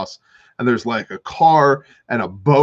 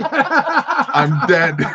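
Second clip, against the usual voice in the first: high, laughing I'm dead; mock-doomed line breaking into laughter; 8 semitones higher than usual. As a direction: laugh through the fear. Scared, laughing.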